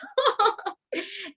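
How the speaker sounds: high, breathy laugh at herself; bright laugh mixed with emotion. Real, not acted. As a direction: laugh at the memory. Laughing at the memory.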